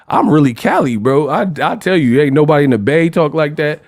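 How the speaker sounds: ordinary talk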